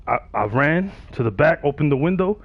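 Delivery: usual speaking voice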